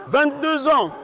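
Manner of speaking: high-pitched, insistent answer; exasperated repeat. Real, not acted. Suspect repeats his age to the officer, exasperated repeat.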